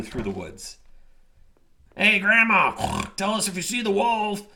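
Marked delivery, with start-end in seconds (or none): 2.0-4.4 loud, high piglet yell. A narrator's reading. Peter Pig racing his brothers, high yell. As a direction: shout it while running past. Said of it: "yelled Peter Pig".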